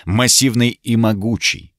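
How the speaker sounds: usual speaking voice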